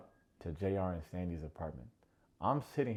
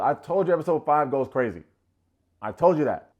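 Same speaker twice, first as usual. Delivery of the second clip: loud, higher, emphatic crowing; hyped, much louder boast; excited I-told-you-so; 5 semitones higher, 11 dB louder than usual. Real, not acted. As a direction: crow that you called it. Triumphant I-told-you-so to viewers.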